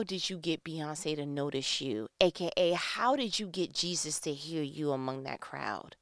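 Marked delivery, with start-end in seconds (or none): none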